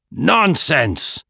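put-on an old man's angry outburst, lightly done; restrained angry bark; roar. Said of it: "he roared".

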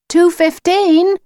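high, drawn-out call. Acted. Lucy calls to the wolf in the forest, calling out high. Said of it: "Lucy called".

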